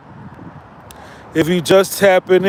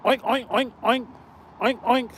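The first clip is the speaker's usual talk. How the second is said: soft mocking oinks; mock pig oinks; 3 semitones higher, 8 dB quieter than usual. Calling Reg the wild pig, quiet mock oinks. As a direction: oink it softly, like a pig squealing to cops. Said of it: "He was the wild pig".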